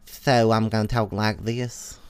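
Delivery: slow Southern lisp, "So" starting as "Tho"; fake lisp. Shown as a demonstration. Demonstrating an affected lisp, slow fake Southern lisp. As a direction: lisp "this" slowly with a Southern drawl. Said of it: "there's a fake lisp".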